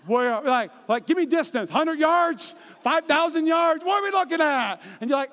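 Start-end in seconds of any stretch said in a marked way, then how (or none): 1.7-4.8 high-pitched, hammed-up questioning; mock exasperation. Can't see it, asking for distance, mock exasperated. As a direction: demand it, pitched up in mock frustration. Exasperated demand for directions, acted.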